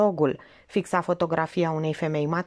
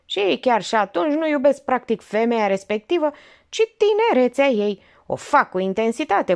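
higher, knowing lecture tone; worldly old aunt lecturing; 6 semitones higher, 4 dB louder than usual. Acted. Aunt lecturing Olga on old men, knowing. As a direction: lay out her cynical wisdom.

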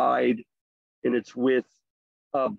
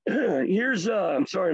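losing voice; 6 semitones higher than usual. Real, not acted. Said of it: "I'm losing my voice a little bit".

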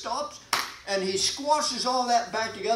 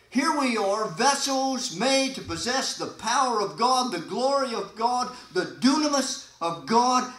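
rising, louder preaching climax; building preaching; 3 semitones higher, 3 dB louder than usual. Acted. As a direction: lift it up, higher and louder. Preaching at full force.

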